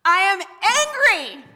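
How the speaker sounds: loud, high, full-force angry cry; whole-body anger. Shown as a demonstration. Preacher showing congregation women's anger, shouted furiously. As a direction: let it burst out with your whole body. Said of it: "say it with my whole body".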